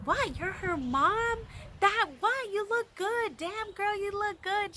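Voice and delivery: put-on high-pitched delighted "damn, girl"; impressed praise. Singer gushing to the mom, high and delighted. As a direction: gush over her like a hyped friend.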